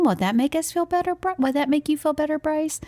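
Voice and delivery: put-on high, coaxing kid voice; imitating eager child. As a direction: pitch it up like a boy anxious to help a friend. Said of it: "I wanna be able to make him feel better".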